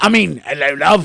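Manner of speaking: loud second stab at a bad Russian accent; mock correcting into a botched Russian accent; Ray Winston. Host mimics actor remembering he's supposed to be Russian, bad Russian accent. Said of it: "you were supposed to be Russian".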